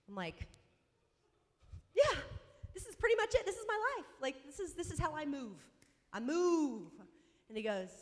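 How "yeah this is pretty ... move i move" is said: high, loud, overeager self-justification; mock-manic, pitched-up retort; defensive insistence. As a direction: insist brightly that all is normal. Defensive answer to her dad, retold.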